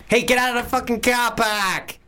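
high, strained Boston outburst; Boston accent. Shown as a demonstration. Actor showing off a film character, high Boston accent. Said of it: "That's from Boston accent".

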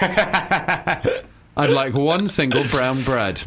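very slow, each word spaced out; mock-serious slow request; slow deliberate order. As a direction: drag out the order like a joke. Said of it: "joke order said slowly".

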